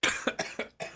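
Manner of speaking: real coughing fit, choking on a chip; harsh dry cough. Real, not acted. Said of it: "I'm choking on an Arriba".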